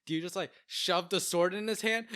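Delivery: normal talking voice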